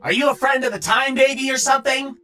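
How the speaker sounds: high, loud villainous interrogation; irritated demand. Acted. Bill grills the unknown stranger, loudly irritated. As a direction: fire the question in a piercing, annoyed voice.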